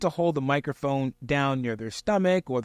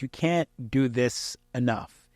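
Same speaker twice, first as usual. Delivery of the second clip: usual speaking voice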